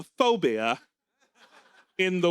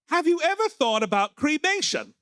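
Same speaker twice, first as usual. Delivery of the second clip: put-on mild old lady Margaret; 8 semitones higher than usual. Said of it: "Margaret turns to Eve and says".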